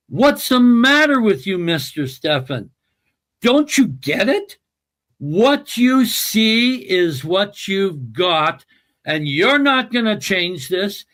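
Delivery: retold rebuke, pitched high and emphatic; high, insistent acted scolding; stern confrontation. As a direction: confront him as the exasperated doctor. Acts out psychiatrist confronting him alone.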